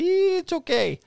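high-pitched put-on Polish guy voice. Officer mimicking the Polish guy in his story, high mock accent. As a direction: draw it out high as a caricature Polish man. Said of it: "there's this Polish guy".

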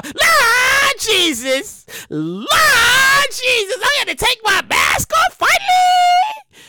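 hammed-up joy at unmasking; ecstatic cry. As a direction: praise the heavens with relief.

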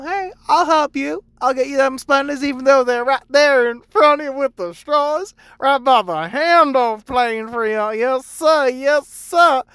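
high, loud, over-sweet customer-service voice; mock-polite service voice. Barista to customer who ignores the straws, mock-polite and loud. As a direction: ham up the eager helper for a clueless customer.

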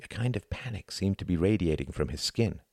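ordinary delivery, nothing marked

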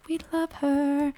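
high, slow mock fawning; mock adoration. Mimicking fans who turned around, mock adoration. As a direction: draw it out like a fawning fan. Mocks fickle fans' sudden love.

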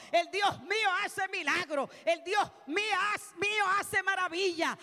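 loud, high sermon cry building to a shouted hallelujah; soaring pulpit shout, climbing in pitch; full-force preaching. Real, not acted. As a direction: preach Ruth's story at full volume.

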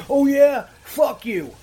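imitated anger, high and loud at first; retold mad comeback. Insulted victim snapping back at prankster, mock anger. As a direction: mimic a fuming caller hitting back. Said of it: "they just keep getting madder and madder and go".

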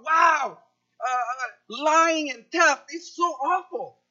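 mock horror at theft. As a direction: act scandalised over lying and theft. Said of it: "selective indignance".

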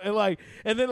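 ordinary talk

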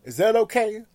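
hyped crowd call. Pretend. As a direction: whip up an imaginary audience. Asks audience for applause.

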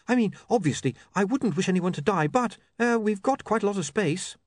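put-on high-pitched, backtracking young woman; high, faintly embarrassed reporter; flustered Saccharissa. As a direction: justify yourself in a high voice, hesitating. Voices Saccharissa.

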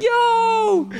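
sudden high loud "Yo!"; shout. Retold or imitated. Dad yelling as son's ball hits the new TV. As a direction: yell it in alarm.

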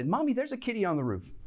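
put-on high, quick child voice; insistent little girl. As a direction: pester mom like a small kid. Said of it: "they come back in a couple more times".

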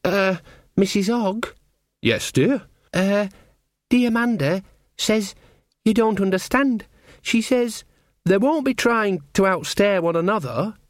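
put-on high, hesitant girlish voice; a shy girl passing on bad news; nervous young girl. A narrator's reading. Perdita timid, high girl voice.

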